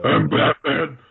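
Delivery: a hammed-up Batman line. Friend teasing about Batman actors, mock Batman voice.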